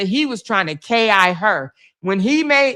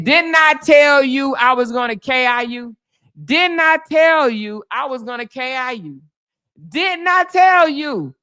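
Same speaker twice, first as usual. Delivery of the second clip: hammering reproach; 4 semitones higher than usual. Retold or imitated. Officer berating the man she warned, hammering reproach. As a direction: drive each repeat home, pitched up. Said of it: "she beat it in him like".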